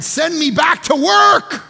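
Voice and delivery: high, loud, slow cry of Send me back; mock desperate shout. Husband pleading to return to work, mock shout. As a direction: beg to escape home, hammed up.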